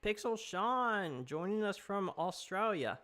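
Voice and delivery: a deliberately bad Australian impression; mock Australian accent. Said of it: "That's a horrible accent".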